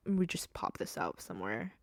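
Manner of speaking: normal talking voice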